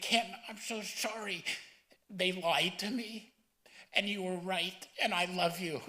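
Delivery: high, strained retelling of a crying father; tearful apology. Retold or imitated. Son retelling dad's phone apology at his memorial, tearful and high. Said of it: "and he was crying".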